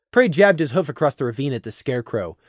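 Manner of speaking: usual speaking voice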